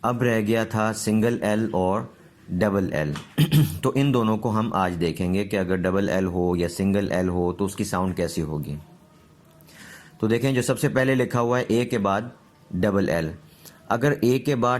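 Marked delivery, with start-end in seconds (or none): none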